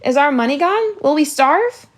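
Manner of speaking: high, loud, frightened questions; rising panic. A narrator's reading. Olive asking if the money is gone, high and panicky. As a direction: fear spilling out in a rush. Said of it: "her voice rising in panic".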